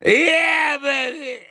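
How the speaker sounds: high, shouted cheer breaking into laughter; excited whoop. Real, not acted. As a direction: whoop it up like a win. Celebrating viewer count.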